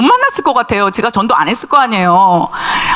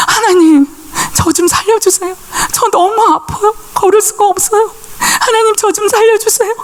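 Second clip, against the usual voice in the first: high, pleading cry to God; crying out to God for rescue, voice pitched high; desperate prayer; 10 semitones higher than usual. Retold or imitated. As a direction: beg God for help through the pain. Praying on knees in pain.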